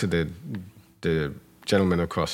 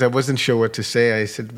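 usual speaking voice

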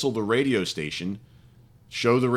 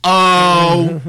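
mock alarm; 3 semitones higher, 11 dB louder than usual.

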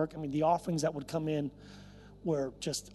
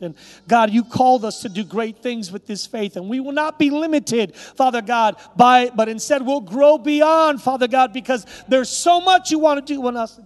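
loud, raised, building preacher's prayer; fervent prayer; 8 semitones higher, 12 dB louder than usual. Real, not acted. Pastor praying for the church to grow in faith, fervent prayer. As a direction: pray it out with mounting force. Prayer rising to full force.

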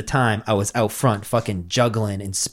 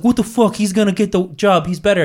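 high indignant whine; high mock indignant protest; outraged kid; 8 semitones higher than usual. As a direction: squeal it like a jealous kid. Other kid protesting at job interview.

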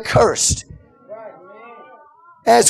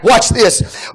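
ordinary talk

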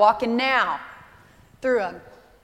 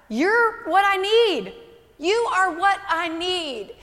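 heartfelt realization; 6 semitones higher, slower than usual. Retold or imitated. Realization voiced as prayer.

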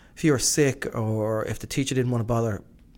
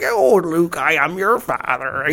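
Vader's line in a hammed-up Cornish country voice, slow and louder; Cornish Darth Vader; 5 semitones higher, 6 dB louder, slower than usual. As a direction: play the menace as a friendly Cornish farmer. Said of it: "Darth Vader had been like".

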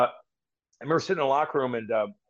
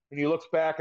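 ordinary talk

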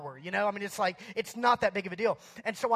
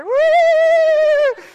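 long, high, loud whoop; gleeful whoop; 17 dB louder than usual. Retold or imitated. Kid defying the lifeguard, high drawn-out whoop. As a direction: whoop like a kid flying down a slide. Imitates kid going down the slide.

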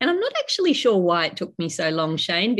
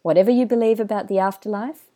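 polished announcer read over music; smooth, measured podcast host intro. Acted. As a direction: draw the listener in like a show opener.

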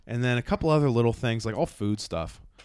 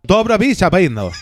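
loud, high mock-foreign gibberish; confident invented Slavic-sounding speech; fake foreign language; 8 semitones higher, 13 dB louder than usual. Shown as a demonstration. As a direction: deliver made-up language as if fluent. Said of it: "It sounds like a real".

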